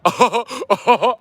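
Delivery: high, slow mocking uh-huh; mock podcaster. Mocking Chicago rappers on a podcast, high sneering grunt.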